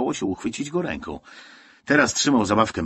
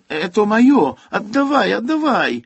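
put-on high, loud, desperate child's yelling; piercing shout; 11 semitones higher, 7 dB louder than usual. A narrator's reading. Boy jumps for his teddy, screaming at the soldier in Russian. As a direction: yell shrilly for it to be given back. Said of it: "przeraźliwie krzyczał".

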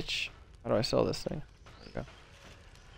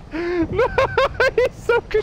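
very high, squealed through laughter; laughing hard; 3 dB louder than usual. Real, not acted. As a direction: barely get the words out for laughing. Talking through a laughing fit.

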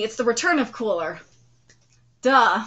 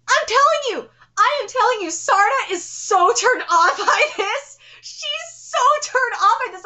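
excited insistence; 9 semitones higher than usual. Real, not acted. Excited insisting while watching.

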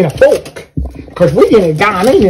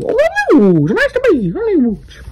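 put-on squeaky little boy voice, much higher; small boy character voice; high little-kid voice; 9 semitones higher than usual. Little Woods introduces himself again.

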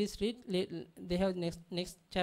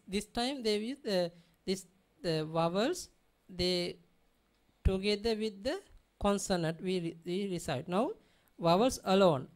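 normal talking voice

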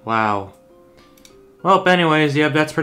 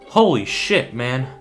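astonished; 2 semitones higher, 4 dB louder, slower than usual. Real, not acted. Player stunned by the cutscene reveal, astonished. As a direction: let real shock lift the voice.

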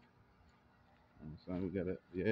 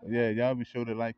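ordinary delivery

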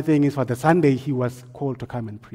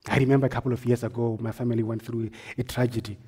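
normal talking voice

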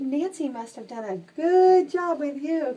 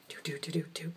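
whispered ditty; 6 semitones lower, 10 dB quieter, faster than usual. Real, not acted. Humming to the cat.

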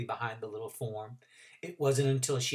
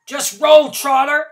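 muffled; 13 semitones higher, 15 dB louder, slower than usual. Acted. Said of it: "said a muffled voice".

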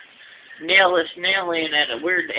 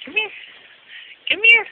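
calling the pet. Pretend. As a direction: squeak come here up high. Calling the cat.

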